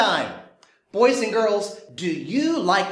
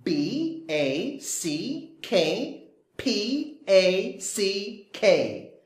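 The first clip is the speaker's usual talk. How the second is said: spelling out; 3 dB quieter than usual. Shown as a demonstration. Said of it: "He is carrying a backpack".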